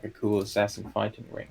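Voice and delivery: normal talking voice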